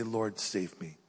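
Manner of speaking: soft, low and hushed; a quiet, humble call to the Lord; quiet plea. Shown as a demonstration. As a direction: pray it under your breath. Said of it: "You need to say".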